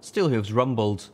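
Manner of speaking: usual speaking voice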